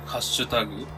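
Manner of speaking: ordinary talk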